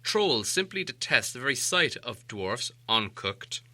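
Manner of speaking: ordinary delivery, nothing marked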